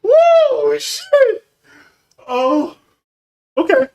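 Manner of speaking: high, loud voice recovering from hard laughter; real laughing fit. Real, not acted. Streamer reacting to a viewer's joke, laughing hard. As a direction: squeal it out, still cracking up. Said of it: "I'm still laughing at the first one".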